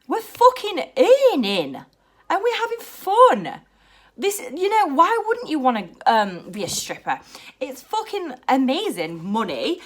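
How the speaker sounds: high-pitched impassioned rant; fired-up, heartfelt outburst; really emotional. Real, not acted. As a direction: get worked up defending yourself, pitch climbing. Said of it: "I'm getting really emotional talking about this".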